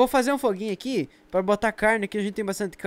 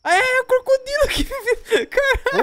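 high, slow, louder words through laughter; laughing exclaim; 14 semitones higher, 4 dB louder, slower than usual. Real, not acted. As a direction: react laughing at the croc. Ri do crocodilo. (exclamação rindo alto)

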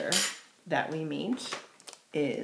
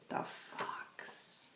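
whisper; 17 dB quieter than usual. Shown as a demonstration. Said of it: "whispered name of the fox".

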